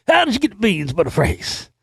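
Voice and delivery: comic movie-quote voice; movie character impression. Repeating the movie character's line.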